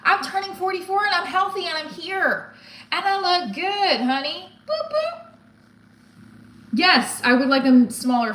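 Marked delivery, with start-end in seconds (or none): 0.0-4.5 higher, hammed-up sassy diva voice; birthday pep talk to herself, sassy and high; sassy self-affirmation. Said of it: "I have to say". 4.7-5.3 playful boop noises. Acted. Playful sound effect after pep talk.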